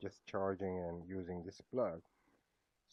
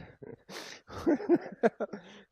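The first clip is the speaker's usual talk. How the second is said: high, loud real laugh at a surprise; burst of laughter; 8 dB louder than usual. Real, not acted. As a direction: crack up at the gadget.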